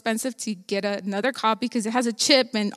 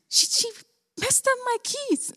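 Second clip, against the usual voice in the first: retold indignant protest; 11 semitones higher, 4 dB quieter than usual. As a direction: protest like a wronged kid, pitched way up. Retold protest blaming mom to God.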